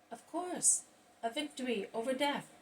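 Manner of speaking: pointed demand. A narrator's reading. Another opponent demands proof from Jesus, pointed and high. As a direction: jump in high, challenging him.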